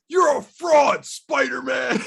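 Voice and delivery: high, loud furious accusation; villain shout. Acted. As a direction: yell it at your enemy. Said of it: "It's either that or".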